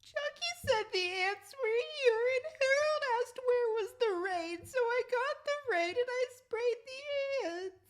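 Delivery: high blubbering sob through the words; sobbing. Acted. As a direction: cry it out like a scolded child.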